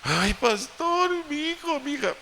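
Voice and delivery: very high, slow wailing lament; hammed-up tearful plea; mock crying mother. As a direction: wail to the pastor like a weeping parent. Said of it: "vienen llorando".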